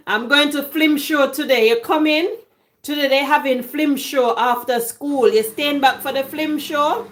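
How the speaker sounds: lightly voiced Jamaican schoolchild chatter; a hint of patois, flim show after school; Jamaican schoolkid talk. Recalling schoolyard talk about flim show, light Jamaican voice. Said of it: "In Jamaica, we say flim".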